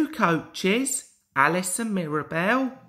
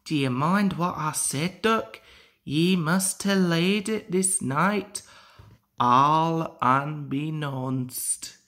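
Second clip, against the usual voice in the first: put-on Scottish accent. Donald teasing Duck.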